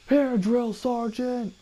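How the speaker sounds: high, drawn-out recruit's call; recruit answering. Retold or imitated. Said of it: "I go".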